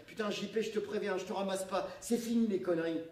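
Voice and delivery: loud, high exasperated shout; cried warning. Acted. Camille shouts up at JP from her bike, loud and fed up. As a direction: yell up a warning to the fool.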